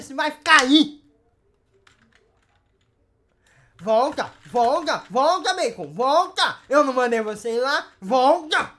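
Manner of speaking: loud, insistent scolding commands; shouted orders. Real, not acted. Host ordering a player who left early back, shouting. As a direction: yell repeatedly to call them back.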